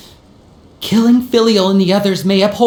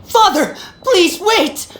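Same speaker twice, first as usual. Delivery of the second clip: urgent plea; 9 semitones higher, slower than usual. Acted. Son halts execution order.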